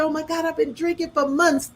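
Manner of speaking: high-pitched nervous blurting, re-enacted; nervous confession. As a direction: retell a panicky admission to the doctor. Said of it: "I was so nervous telling the doctor".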